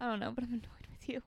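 quick whispered grumble; fast whisper. Real, not acted. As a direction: rush it out in a whisper. Whispered complaint.

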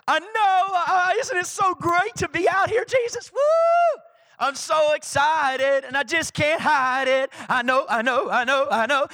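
high, whooping, gleeful outburst; excited God the Father. Acted. God answering Jesus in the desert, bursting with excitement. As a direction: gush like an overjoyed dad who can't hold it in. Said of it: "the Father getting so excited".

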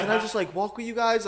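lightly voiced pleading woman. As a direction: imitate her asking, pitched well up. Voices the stranger asking to tag along.

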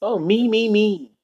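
put-on a high, soft childish whine; mocking me me me. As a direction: squeak it out like a spoiled brat. Mocking the Grinch's selfish refrain.

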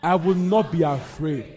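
loud, emphatic declaration; confident proclamation. Shown as a demonstration. Preacher urging the congregation to say it confidently, loud and firm. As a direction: declare it boldly so the room follows. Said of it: "Say it with confidence right now".

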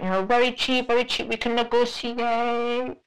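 high-pitched haggling masseuse voice; massage worker. Retold or imitated. Masseuse offering a cheap price, high mimicked voice. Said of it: "she was like".

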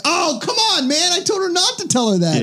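very high, strained mock protest; exasperated protest. Scolding Andreas for telling his mother, in a very high mock whine. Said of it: "I was like".

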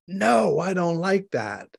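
high, exaggerated scolding no; imitated angry rebuke. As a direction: act out the harshness she heard. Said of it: "So what she heard was".

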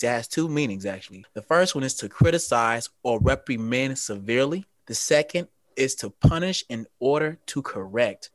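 ordinary delivery

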